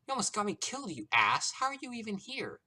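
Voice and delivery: high, loud indignant protest; angry outburst. A narrator's reading. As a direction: snap at the intruder in disbelief. Angry driver confronts intruder.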